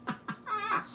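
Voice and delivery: a short high-pitched squeal of a laugh; delighted high laugh; high giggle. Real, not acted. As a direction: burst into a giddy giggle. Celebrating likes on stream.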